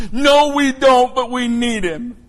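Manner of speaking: high, loud, drawn-out wail, imitating a crying mother; imitated tearful cry. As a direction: cry it out slowly like a pleading mom. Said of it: "And Mom starts crying".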